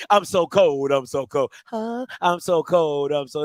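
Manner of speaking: lightly mocking a friend's icy act; mock cold Key. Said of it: "that's Key".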